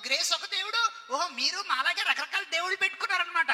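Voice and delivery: high, loud, slow taunting; mocking teacher. Teacher ridiculing the students' God names, high mocking taunt. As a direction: ridicule each name.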